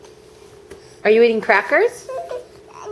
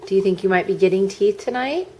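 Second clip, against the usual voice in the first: usual speaking voice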